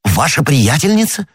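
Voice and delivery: high-pitched, shocked question; startled cry. A narrator's reading. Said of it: "вскричал Д'Артаньян". (поражённый, встревоженный возглас)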